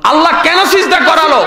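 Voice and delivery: high, loud rhetorical demand; emphatic question. Real, not acted. Preacher challenges the crowd for the reason, loudly.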